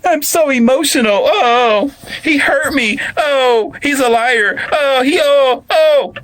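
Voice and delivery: very high, loud mock sobbing; hammed-up victim's bawling; fake crying. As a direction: wail long "oh"s to play the innocent. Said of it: "she's the innocent one by".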